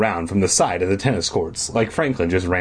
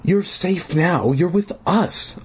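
slow, higher-pitched reassurance; insistent; 9 semitones higher, slower than usual. Acted. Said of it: "I insisted".

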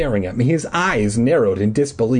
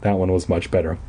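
plain reading voice

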